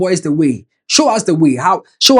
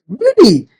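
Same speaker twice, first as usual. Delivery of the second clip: incredulous; 8 semitones higher, 5 dB louder than usual. Real, not acted. Reacting to scolding tweet.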